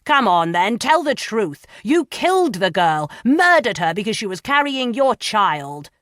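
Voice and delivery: loud, high furious bellow; red-faced bellowing fury. Acted. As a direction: roar it at the prisoner. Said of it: "Pomfroy bellowed".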